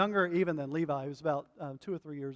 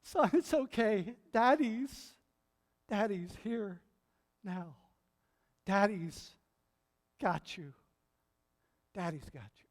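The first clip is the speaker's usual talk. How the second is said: comforting dad; 2 semitones higher than usual. Retold or imitated. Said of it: "saying to him".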